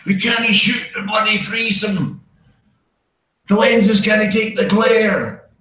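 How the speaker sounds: high, slow, drawn-out complaint called across a set; exasperated film crew shout. Acted. Acted crew on porn shoot.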